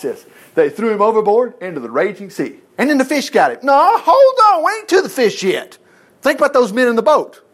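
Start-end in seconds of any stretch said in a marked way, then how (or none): 3.6-5.8 high, loud, stretched interruption; emphatic halt. Real, not acted. Preacher stopping listeners jumping ahead to the fish, high emphatic halt. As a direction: break in high, dragging out the stop.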